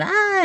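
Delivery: high imitated scream of fleeing kids; mock scream. Scream of nephews fleeing the uncle. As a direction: shriek like a kid bolting from a tree.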